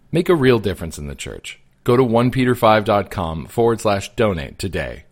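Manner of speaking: loud, upbeat sponsor read. Acted. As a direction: sell it like a radio ad. Sponsor ad read over music.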